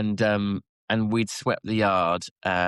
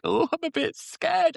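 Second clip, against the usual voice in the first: much higher, slow, timid put-on voice; mock scared. Quoting herself on a feisty horse, very high. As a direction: squeak it out like a nervous rider. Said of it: "I was like".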